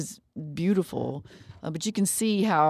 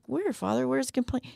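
put-on higher, softer curious children's chorus; eager kids asking; 9 semitones higher, 4 dB quieter than usual. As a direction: ask it like wide-eyed kids in class. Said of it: "we were all like".

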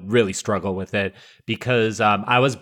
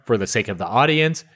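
usual speaking voice

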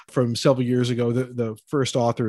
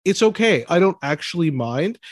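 ordinary talk

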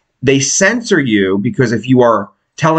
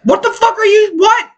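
shocked shout. Real, not acted. Shocked at someone not drinking.

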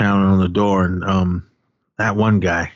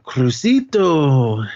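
higher, very drawn-out name; mock taunting call; 5 semitones higher, much slower than usual. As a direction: stretch the name out like a taunting greeting. Retelling Clavo's drawn-out taunting greeting.

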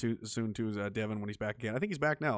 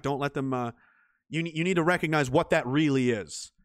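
usual speaking voice

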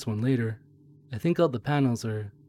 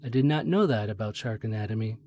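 usual speaking voice

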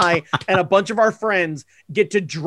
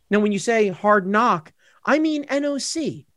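ordinary talk

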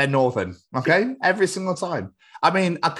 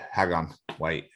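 ordinary delivery

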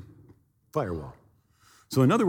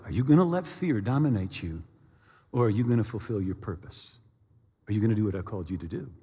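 lowered, softened searching questions; God's inner voice; 5 semitones lower, 5 dB quieter, faster than usual. Retold or imitated. God challenges him about his purpose, quiet solemn questioning. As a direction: press gently, sinking lower and softer. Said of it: "it was like God said".

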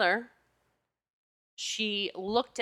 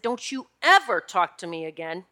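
firm telling-off with a hard hit on "ever"; retold stern warning; 3 dB louder than usual. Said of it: "I pointed at that man and I said".

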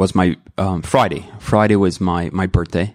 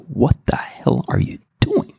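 higher, breathy whisper at himself; whispered self-rebuke; 5 semitones higher than usual. Retold or imitated. Father catching himself mid-anger, whispering in dismay. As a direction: hiss the question at yourself in shock.

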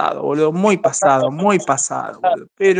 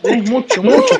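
mocking laugh; 4 semitones higher, 4 dB louder than usual. Real, not acted. Mocking laugh during insult.

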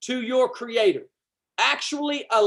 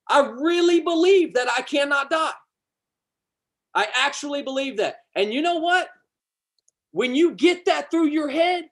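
high, forceful preaching with hard punches; fired up; 5 semitones higher than usual. Real, not acted. Preacher urging listeners to believe in eternal life, fired up. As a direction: press every phrase home, pitched up. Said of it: "I'm getting a little fired up".

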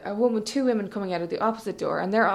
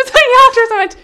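worked-up telling; 14 semitones higher, 14 dB louder, faster than usual. Real, not acted. Storyteller shrieking with amusement.

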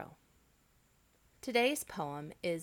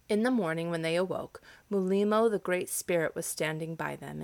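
lively storybook reading with full voice; story narration; 10 dB louder than usual. A narrator's reading. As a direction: tell the tale to an audience. Storyteller reads the tale.

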